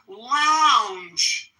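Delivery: Lounge with a long ow vowel and voiced j ending; clear lounge. Shown as a demonstration. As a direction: stretch the ow in Lounge, end on a voiced j. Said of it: "ow vowel sound, ends with a final j".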